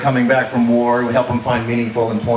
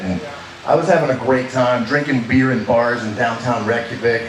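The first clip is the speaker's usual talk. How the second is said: normal talking voice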